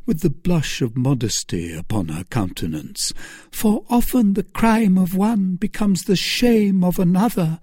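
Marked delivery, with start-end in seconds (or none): 3.5-7.6 high, pleading woman's voice; pleading Milady. A narrator's reading. Milady begging Felton to let her die, high and pleading. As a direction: beg him for death, voice raised high.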